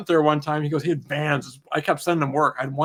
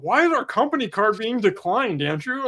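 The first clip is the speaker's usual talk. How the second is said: baffled employee; 7 semitones higher than usual. Retold or imitated. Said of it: "He's like".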